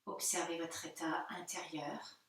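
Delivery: hushed, spacious guiding voice, long pauses; guided meditation. Real, not acted. Yoga teacher guiding listeners into their body, soft meditation voice. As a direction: ease them gently into stillness.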